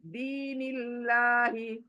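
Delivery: very slow दीनिल्लाही with a thin लाम; thin Allah. Shown as a demonstration. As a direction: stretch दीनिल्लाही out, lips wide. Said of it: "तो देखिए कैसे बारीक हो रहा है".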